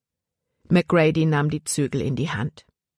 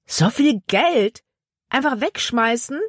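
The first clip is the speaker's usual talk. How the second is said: incredulous bandit; 7 semitones higher than usual. Acted. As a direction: stretch each question in outraged astonishment. Bandit appalled at losing reward.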